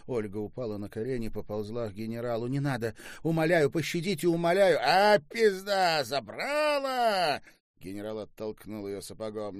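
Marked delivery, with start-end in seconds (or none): 2.5-4.8 quick, rising, high-pitched begging; desperate pleading. A narrator's reading. Olga crawls to the general, desperate begging. 4.8-7.4 loud, high, drawn-out jeer; angry gloating shout. A narrator's reading. General shoves the pleading prisoner away, angry shout.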